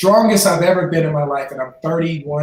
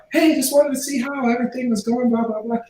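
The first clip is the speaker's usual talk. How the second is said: lightly imitated friend, pitched up; a higher voice for the retold caller; casual check-in call, lightly mimicked; friend on phone; 8 semitones higher than usual. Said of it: "phone call from a friend".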